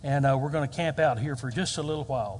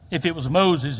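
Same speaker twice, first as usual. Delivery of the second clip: ordinary delivery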